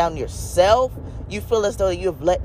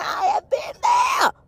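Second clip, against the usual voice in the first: crying out; slower than usual. Real, not acted. Preacher telling hurting listeners, crying out high. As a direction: voice breaking into a high cry. Preacher recalls his own pain.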